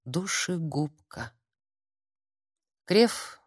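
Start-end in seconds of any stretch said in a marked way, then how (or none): 0.0-1.3 soft, slow, haunted word; inner voice. A narrator's reading. Self-accusation beats in girl's head, slow and hushed. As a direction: draw it out quietly, as a thought. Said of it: "билась в голове".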